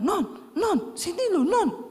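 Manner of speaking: high excited call across the house; husband shouting for his wife, high-pitched call; retold calling out. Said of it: "Dia teriak-teriak".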